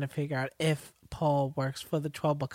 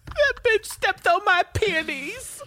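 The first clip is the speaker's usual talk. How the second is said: higher, louder, slow prissy complaint; mock outrage; 8 dB louder, slower than usual. Co-host riffs on Paul's rage, slow mock outrage. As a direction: clutch your pearls over the flowers.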